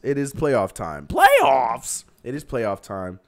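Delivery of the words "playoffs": louder, higher hype shout of playoffs; hype call. Real, not acted. Host hypes his viewers for playoff time, shouting it out. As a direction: sell it like an announcer.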